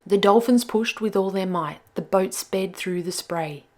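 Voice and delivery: usual speaking voice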